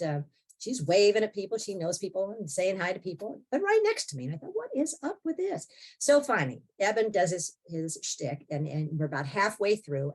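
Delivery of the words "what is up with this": baffled. Retold or imitated. Retold baffled thought.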